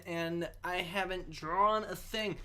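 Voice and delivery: ordinary talk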